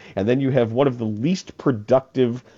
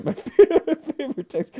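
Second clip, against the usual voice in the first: laughing; 14 semitones higher than usual. Real, not acted. Host laughing mid-sentence.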